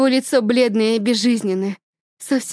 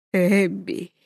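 through gritted teeth; 4 semitones lower than usual. Acted. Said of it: "говорит это сквозь зубы".

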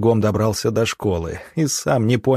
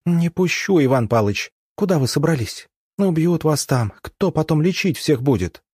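higher-pitched urgent pleading; urgent protest; 6 semitones higher than usual. A narrator's reading. Orderly stopping the doctor leaving, urgent protest. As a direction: protest as if blocking his way.

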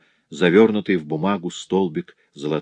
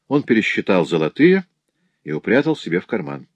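ordinary delivery

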